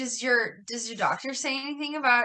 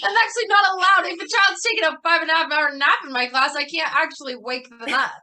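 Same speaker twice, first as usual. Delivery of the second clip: mock exasperated teacher; 5 semitones higher, 4 dB louder than usual. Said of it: "where I'm like".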